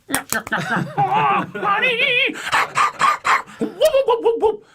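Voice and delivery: very high, loud Stooges nyuks and whoops; the wife doing the full Curly; Curly impression. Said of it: "My little hippie wife is going".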